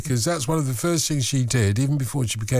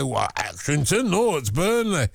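put-on broad, drawn-out Burnley accent; 2 semitones higher, slower than usual. Host imitates a local correcting him on air, mock Burnley accent. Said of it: "and he'd say".

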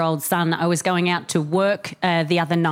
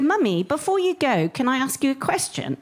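put-on higher-pitched little boy asking; six-year-old English son; 5 semitones higher than usual. Son asking mum before she leaves, imitated high English child voice. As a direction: imitate a well-spoken small child. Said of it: "I have a middle-class English son".

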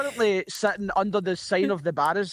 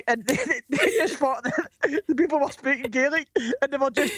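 higher pitch, laughing through the words; 6 semitones higher than usual. Real, not acted. Comedian telling the host of a mix-up, laughing. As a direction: tell it through real laughter.

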